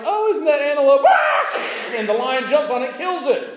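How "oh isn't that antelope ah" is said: high, loud, laughing shriek of shock; laughing alarm. Retold or imitated. Onlooker laughing and shrieking as the lion pounces. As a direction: yelp as the antelope gets caught. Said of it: "and you're like".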